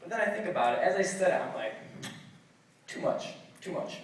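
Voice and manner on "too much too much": soft, unvoiced muttering; regretful aside. Guest realizes he overswore, hushed regret.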